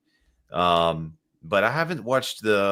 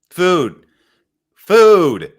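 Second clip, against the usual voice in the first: shouted much higher and louder, building on the second; calling out; 9 semitones higher, 13 dB louder than usual. Acted. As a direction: yell "Food" like a hungry demand. Calling out for food.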